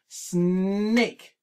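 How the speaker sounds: slow, syllable-clapped snake; chunked syllable by syllable. Shown as a demonstration. Teacher modelling syllables for a child, clapped and slow. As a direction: say snake as one clear clapped beat. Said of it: "Let's try it again".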